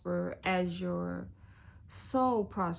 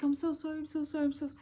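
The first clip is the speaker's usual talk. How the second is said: higher, pleading apology imitated; apologizing client; 8 semitones higher, much faster than usual. Coach imitating clients who keep apologizing, higher pleading. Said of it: "People like".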